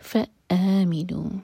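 فآمنوا slow with a clean stop on the hamza; hamza cut-off. Shown as a demonstration. Teacher recites slowly with a clear hamza stop. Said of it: "حمزہ پر cut off sound".

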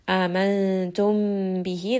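Amantum bihi very slow, mim merged into ba with a nasal hum; merged nasal recitation. Shown as a demonstration. Teacher demonstrating idgham to students, slow nasal recitation. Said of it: "Merge this together, mim and ba".

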